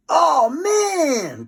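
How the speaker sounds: high, loud amazed cry; boy shouting in delight; excited cry. A narrator's reading. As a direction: cry out in thrilled disbelief. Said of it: "cried Cheese Ball".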